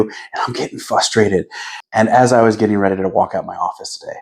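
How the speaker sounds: usual speaking voice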